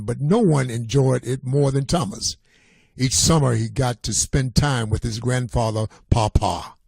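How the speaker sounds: ordinary delivery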